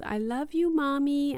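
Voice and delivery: a hint of a small child's goodnight; high child-like reply to mother; imitated child. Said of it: "And he says".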